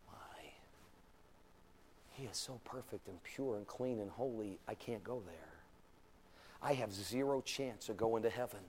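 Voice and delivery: hushed awe. Acted. Sinner sees God's holiness, hushed and low. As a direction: breathe it as a humbled sinner.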